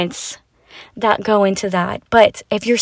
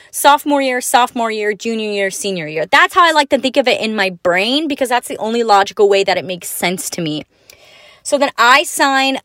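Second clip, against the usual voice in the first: usual speaking voice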